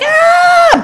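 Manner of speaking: short high startled scream of the onlookers; a sharp, high gasp-scream of horror; shocked scream. Retold or imitated. Guests rushing out onto the terrace, a high shocked scream. Everyone reacts to the shooting.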